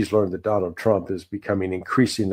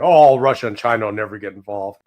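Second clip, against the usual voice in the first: mock dismissive experts; 8 dB louder than usual. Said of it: "experts in the United States".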